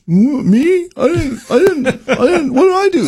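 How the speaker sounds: high-pitched mock protest of innocence; LeBron. Mimicking a star who denies fouling a guy, high mock protest. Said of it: "we're not seeing a lot of the LeBron".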